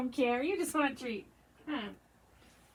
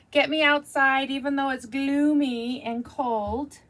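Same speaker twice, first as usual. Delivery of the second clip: ordinary talk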